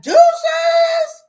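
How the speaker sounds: joyful farewell shout; gleeful shout. Pretend. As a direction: shout goodbye with joy. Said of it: "And I'll be like".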